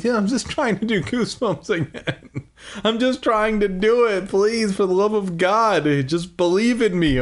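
high, laughing mock plea; hammed-up pleading. Reader pleading with fans to believe him, hammed-up pleading. Acting out plea to his listeners.

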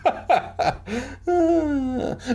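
high-pitched cackle at own pun; high laugh. Real, not acted. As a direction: burst into a long, high laugh.

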